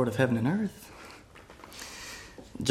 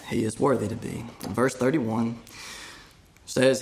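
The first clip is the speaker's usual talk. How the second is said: ordinary talk, nothing marked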